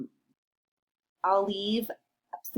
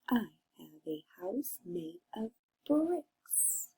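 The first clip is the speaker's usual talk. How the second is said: slow, softer repeat for the class to copy; softer echo; 10 dB quieter, slower than usual. Shown as a demonstration. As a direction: gently say it again slowly. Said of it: "Go ahead".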